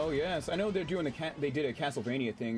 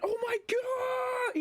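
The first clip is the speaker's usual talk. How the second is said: high, drawn-out anime dub scream; long, piercingly high mock scream; Joseph Joestar scream; much slower than usual. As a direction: imitate an over-the-top Japanese dub yell, stretched right out. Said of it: "He sounds like the Japanese dub of JoJo's Bizarre Adventure".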